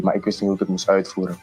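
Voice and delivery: ordinary delivery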